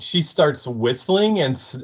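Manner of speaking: ordinary talk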